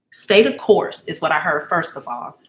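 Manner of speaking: ordinary delivery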